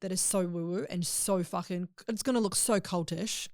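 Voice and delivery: ordinary talk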